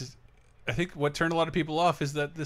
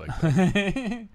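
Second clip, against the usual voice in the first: laughing; 9 semitones higher, 4 dB louder than usual. Real, not acted. Laughing slow mock.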